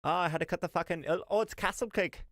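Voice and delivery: high, whiny mock of a proud baker; mock whiny castle-cake guy. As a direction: sneer it out like a smug show-off. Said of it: "and you're like".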